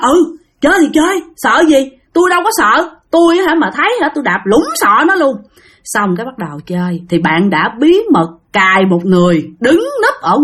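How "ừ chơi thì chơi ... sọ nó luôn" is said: high, cocky bravado; mock-brave boasting. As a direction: brag like nothing scares you. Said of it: "ông đó ông khoái dữ lắm".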